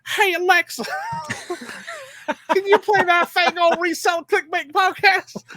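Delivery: high, loud, hammed-up Virginia accent; Sonia's Virginia accent. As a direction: holler it in a mock Virginia twang. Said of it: "she's the one that has the accent".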